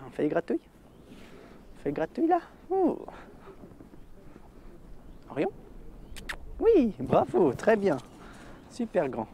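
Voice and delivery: high cooing praise with whispers; talking to a dog. Real, not acted. Handler praising dog Orion.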